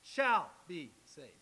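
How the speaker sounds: "Shall" punched loud, then slow "be saved"; stress on Shall. Shown as a demonstration. Preacher repeating the promise of future salvation, stressing "Shall". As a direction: hit "Shall" hard and draw the phrase out. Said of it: "that's future, isn't it?".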